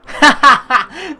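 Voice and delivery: high, loud squealing giggle; giddy laugh. Real, not acted. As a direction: burst out in a delighted giggle. Delight at smiley face.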